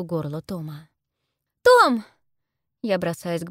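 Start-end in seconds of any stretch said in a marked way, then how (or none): 1.6-2.0 high, loud cry of the name; sudden high shout; alarmed cry. A narrator's reading. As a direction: cry out to your brother in alarm. Heroine cries out to captive brother.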